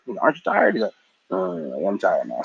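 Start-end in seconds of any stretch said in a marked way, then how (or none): 0.2-0.7 high coaxing voice. Retold or imitated. Aang coaxing Appa again. 1.3-2.4 low mock Appa. Appa voiced low, giving in.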